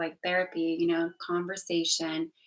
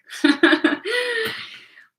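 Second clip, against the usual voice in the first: high laugh breaking toward tears; a laugh that's half a sob; laughing through tears about her year; laugh-cry; 7 dB louder than usual. Real, not acted. Laughs while recounting the song.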